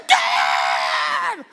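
put-on piercing high kid's shout; squealing, long-held call; excited son. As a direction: imitate a boy bursting in, loud and drawn out.

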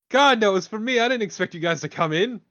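loud, high-pitched indignant protest; exasperated outburst. Acted. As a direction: burst out in outrage over the pizza. Quarrel over eaten pizza.

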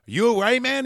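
lightly voiced cop; concerned cop. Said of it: "a cop ... and he goes".

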